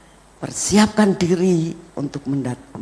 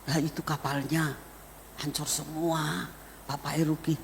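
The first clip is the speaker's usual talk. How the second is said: much softer, dejected admission; subdued confession; 10 dB quieter than usual. Retold or imitated. Father explaining his ruined shipment to his family, subdued. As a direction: own up quietly to the family.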